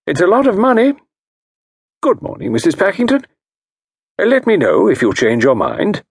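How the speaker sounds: diagnose her case with calm authority; a genteel consultant voice; Parker Pyne. Acted.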